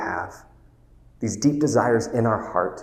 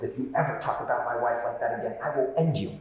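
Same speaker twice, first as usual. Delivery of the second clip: a retold hushed menace from the therapist; quiet threat; 7 dB quieter, faster than usual. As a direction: threaten him softly, holding the anger down. Therapist threatens Will, retold quote.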